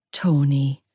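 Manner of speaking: mother calming her husband with a sigh. A narrator's reading. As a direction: sigh his name gently.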